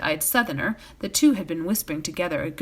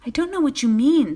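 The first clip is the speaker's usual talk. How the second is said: higher, louder alarmed denial; annoyed and alarmed; 7 semitones higher, 6 dB louder than usual. A narrator's reading. Frodo caught out by Strider, alarmed and annoyed. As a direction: blurt it out, rattled and cross. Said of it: "annoyed and alarmed".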